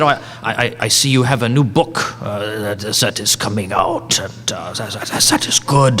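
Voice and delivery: put-on low, halting German accent; his best German accent, pitched low. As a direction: imitate a German scholar's careful English. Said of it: "that's my best German, German accent I can do".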